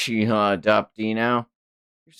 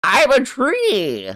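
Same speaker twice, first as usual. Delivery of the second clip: put-on high, slow, creaky old man voice; 5 dB louder, much slower than usual. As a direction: drawl it out as a frail old man. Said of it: "I won't get to do the old man voice".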